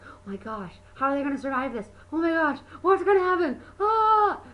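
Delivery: hammed-up panicky viewer ending in a gasp; mock freaking out. Imitating boyfriend freaking out at the movie, mock panic. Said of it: "freaking out".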